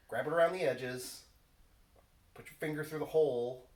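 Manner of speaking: scolding like a fussy dad. Real, not acted. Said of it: "you're such a dad sometimes".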